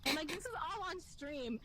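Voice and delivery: ordinary talk, nothing marked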